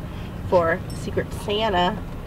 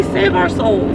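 panicked plea; 9 semitones higher, slower than usual. Real, not acted. Nearly hit in traffic.

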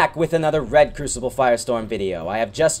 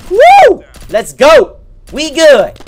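loud, much higher victory whoop; excited whoop; 13 semitones higher, 11 dB louder, much slower than usual. Real, not acted. Gamer cheering his own kill, loud whoop.

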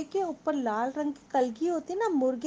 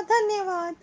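bright, loud, higher thank-you; rooster's thanks; 7 semitones higher, 5 dB louder than usual. Acted. Teacher voicing the rooster thanking the fox, higher and louder. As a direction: chirp the thanks out proudly. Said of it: "मुर्गे ने कहा".

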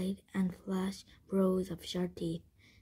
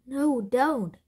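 loud, higher-pitched protest; yelling; 5 semitones higher, 8 dB louder than usual. A narrator's reading. Jack yelling as the camel chews his leather bag. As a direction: yell it in alarm. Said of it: "yelled Jack".

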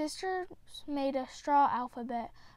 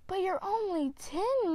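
a mother's protest, pitched higher; worried protest; 6 semitones higher, 3 dB louder than usual. Acted. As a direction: object in alarm to a ten-year-old leaving. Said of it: "Maman cried".

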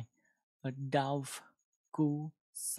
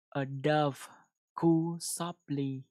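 slow model reading; 6 dB louder, slower than usual. Shown as a demonstration. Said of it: "Nghe nha".

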